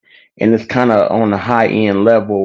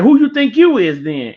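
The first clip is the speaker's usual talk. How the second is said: much higher, mock-hostile question; nasty challenge; 13 semitones higher than usual. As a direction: act out a disrespectful comeback. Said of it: "like, nasty with him".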